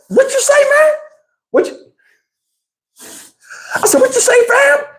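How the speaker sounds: high, squeaky challenge; mock challenge. Teller retelling how he squared up, high mock challenge. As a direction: pitch it up as a dare.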